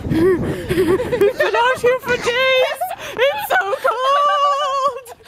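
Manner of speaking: high, slow hammed-up wail; mock wailing. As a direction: whine it like a stranded explorer. Mock despair on the hunt.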